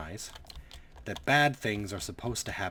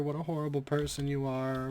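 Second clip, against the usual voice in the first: "horrible" whined higher, mock-tearful; pitched-up sneering imitation of the girl; mocking whiny girl; 7 semitones higher than usual. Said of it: "she immediately cried".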